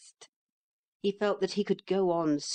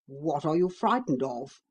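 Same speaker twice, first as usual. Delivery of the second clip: horse voice; 2 semitones lower than usual. A narrator's reading.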